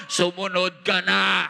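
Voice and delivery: loud, slow, insistent command; slow, forceful shouted urging. Acted. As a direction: boom it out as the Lord's call. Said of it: "mas lalong lumalakas ang boses ng Panginoon".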